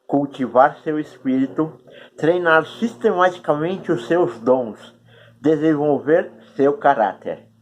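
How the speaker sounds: talking around a cork held in the mouth. Real, not acted. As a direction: speak with a cork between your teeth. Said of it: "É engraçado falar com essa rolha na boca".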